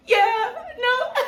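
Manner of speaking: high, giggling yeah no; giggly flirting.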